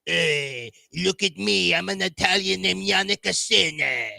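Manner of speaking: hammed-up Italian, slowing on the name; Italian accent. Shown as a demonstration. Introducing himself as an Italian, exaggerated Italian accent. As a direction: put on a cartoonish Italian accent. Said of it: "do that in your Italian accent again".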